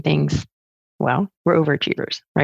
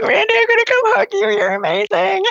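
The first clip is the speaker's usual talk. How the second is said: delighted. Retold or imitated. Retelling her delighted reply.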